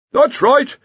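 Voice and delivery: high, loud shout. Acted. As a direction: call out urgently across the beach. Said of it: "the Dean shouted".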